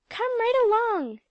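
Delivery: high loud eager call; gusty cry. A narrator's reading. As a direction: sweep her friend along with a shout. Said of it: "cried Alexia gustily".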